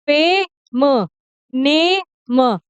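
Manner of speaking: फेम and नेम split into long 'ay' plus a separate 'm'; sounded out. Shown as a demonstration. Teacher breaks the words down for learners, sounded out. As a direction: draw out फे and ने, then add the म. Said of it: "फे का sound आ रहा है".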